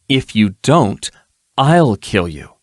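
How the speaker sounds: deliberate, measured menace. Acted. Erilyn slowly threatens the captive with death. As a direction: threaten him slowly and calmly.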